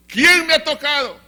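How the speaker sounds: loud, high demand to a crowd; calling out. Acted. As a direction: call the question out over a crowd. Jesus calls out a third time. (pregunta lanzada a gritos)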